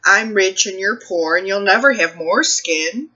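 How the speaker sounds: ordinary talk, nothing marked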